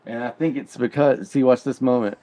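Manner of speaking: ordinary talk, nothing marked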